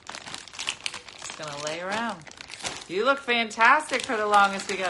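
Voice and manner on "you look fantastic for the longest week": ordinary delivery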